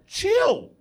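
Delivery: ordinary delivery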